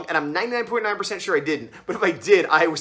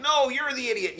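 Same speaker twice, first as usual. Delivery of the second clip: higher-pitched retort at ordinary volume; mock yell back; 8 semitones higher than usual. Narrator answering someone yelling at him, mock indignant. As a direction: toss back a half-hearted mock yell. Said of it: "yell back".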